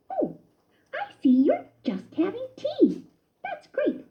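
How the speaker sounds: high bright mouse voice; a cousin keen to watch him eat; lightly voiced happy mouse; Ced explaining cheerfully. A narrator's reading. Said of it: "Ced explained".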